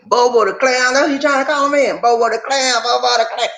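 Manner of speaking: high, gleeful mocking refrain; amused, high-pitched jeering; teasing mockery. Real, not acted. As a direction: poke fun in a high repeated chant. Mocking a nickname, repeated as chant.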